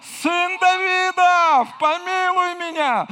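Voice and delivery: long, high, loud drawn-out cry. Acted. Blind man calls out to Jesus, a high loud pleading cry. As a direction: call across the crowd with all you've got.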